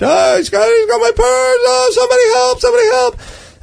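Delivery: high-pitched imitated cries for help; bellowing woman. Robbed woman calls for help from the street, imitated bellowing. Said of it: "just bellowing".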